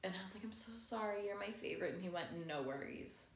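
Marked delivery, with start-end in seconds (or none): 0.4-1.9 soft, sheepish mock apology; retold apology. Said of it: "I apologized". 2.5-3.2 low, soft imitated brush-off; low dismissive reply. Celebrity's brief reply, retold.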